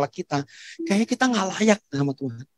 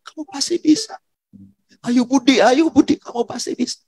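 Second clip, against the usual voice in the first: menirukan suporter, nada tinggi menyemangati; sorakan suporter; 6 semitones higher than usual. Retold or imitated. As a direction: seruan semangat yang makin naik untuk tiap nama. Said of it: "Dia kayak supporter kita". (sorak penyemangat bernada tinggi)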